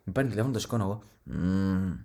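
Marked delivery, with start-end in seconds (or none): 1.2-2.0 fake sleepy hum. Faking sleepiness on phone.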